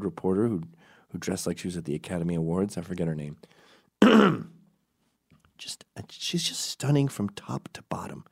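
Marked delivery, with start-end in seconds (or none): 5.6-8.2 hushed, admiring aside; awed whisper. Real, not acted. Admiring Kimberly to the audience, whispered. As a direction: breathe it out in awe.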